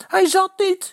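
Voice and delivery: very high, loud protest; high indignant yell; shouting. A narrator's reading. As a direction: shriek it out in protest. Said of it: "schreeuwt Eddy".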